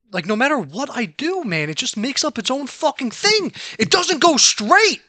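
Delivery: rising into a high, loud, slowed yell; angry shout. Real, not acted. Gamer shouting at a character that won't go straight, rising yell. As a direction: lose it at the controls, building to a shout. Raging at game controls.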